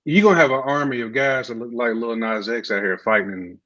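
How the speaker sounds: ordinary talk, nothing marked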